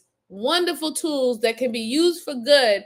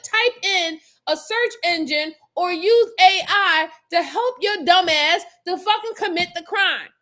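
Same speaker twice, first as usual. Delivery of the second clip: ordinary talk, nothing marked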